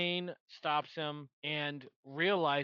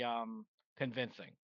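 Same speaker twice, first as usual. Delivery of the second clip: ordinary delivery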